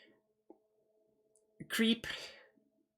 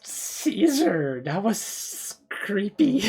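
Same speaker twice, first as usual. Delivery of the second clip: excited; 3 semitones higher, 6 dB louder, slower than usual. Real, not acted. Reacting loudly to creepy video.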